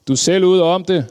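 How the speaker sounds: loud, high accusing shout; råb. A narrator's reading. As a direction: shout the blame at a condemned man. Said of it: "råbte en mand". (anklagende råb)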